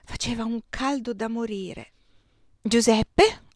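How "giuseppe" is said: called loud, to be heard across the room; calling out. A narrator's reading. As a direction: call his name so he hears. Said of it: "dissi io per farmi sentire". (chiamato forte per farsi sentire)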